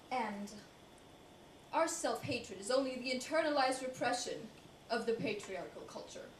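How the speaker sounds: ordinary talk, nothing marked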